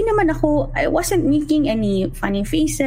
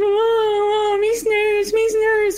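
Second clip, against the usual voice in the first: put-on high, crying little child calling for the nurse; 9 semitones higher, much slower than usual. Scared toddler crying for the school nurse, high and tearful. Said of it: "Kasi iyak siya na yun".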